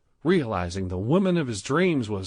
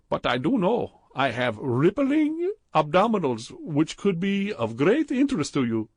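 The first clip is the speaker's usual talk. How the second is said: put-on higher, louder, heavy Indian accent; thick Indian accent; 8 semitones higher, 4 dB louder than usual. A narrator's reading. Clerk Badrul flirting with Stephen in the jacuzzi, thick Indian accent.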